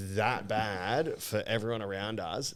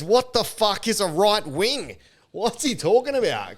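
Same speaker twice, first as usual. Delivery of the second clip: mocking heckler; 10 semitones higher, 8 dB louder than usual. Comic mimics heckler shouting over Amos's set, loud and high. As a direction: ham up the clueless heckler again. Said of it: "He goes".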